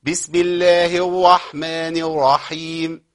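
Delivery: slow, measured tajweed recitation; Quran recitation. Shown as a demonstration. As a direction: recite it slowly with full tajweed. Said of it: "في الراءات المشددة". (تلاوة مجودة بطيئة)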